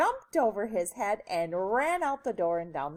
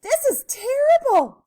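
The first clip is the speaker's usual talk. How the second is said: loud, high-pitched dismay; very high, dramatic outcry; alarmed mother; 12 dB louder than usual. A narrator's reading. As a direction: cry out like a horrified storybook mom. Mother reacts to runaway hair.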